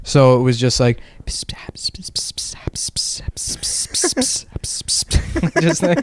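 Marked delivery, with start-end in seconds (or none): 1.3-5.6 long whispered hiss of S's; hissing S sounds. Retold or imitated. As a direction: hiss like a snake on a plane. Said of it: "sibilance of their".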